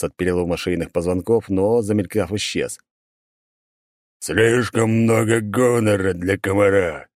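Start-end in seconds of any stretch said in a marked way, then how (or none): 4.2-7.1 slow, chittering demon voice; chittering insect creature. Acted. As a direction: drag it out like a buzzing insect demon. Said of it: "Стрекочущий голос как будто двоился".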